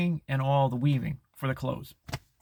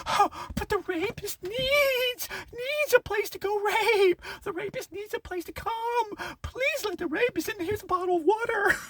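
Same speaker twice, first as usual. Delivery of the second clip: very high, slow, cooing falsetto; mocking sappy woman. Mocking the woman with love to give, high cooing falsetto. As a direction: coo it like a sappy doting woman. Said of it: "the woman with no, nobody to give the love to goes".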